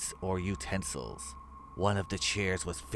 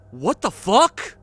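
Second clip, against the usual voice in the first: loud, slow, high shocked outburst; higher shouted what the fuck; 12 semitones higher, 15 dB louder, slower than usual. Acted. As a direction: yell it as the horror sinks in. Sudden discovery of missing body.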